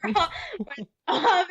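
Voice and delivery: laughing through the words. Real, not acted. Telling the host about the review, laughing. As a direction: giggle as you tell it.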